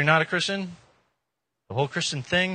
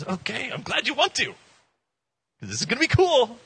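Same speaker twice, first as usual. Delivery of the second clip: upbeat Jesus voice; 10 semitones higher, 4 dB louder than usual. Pretend. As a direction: draw out the promise, pleased and easy. Jesus answers eager believer.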